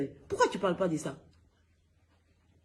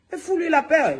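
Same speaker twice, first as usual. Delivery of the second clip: telling her off; 8 semitones higher, 6 dB louder than usual. Real, not acted. Angry call-out to a viewer.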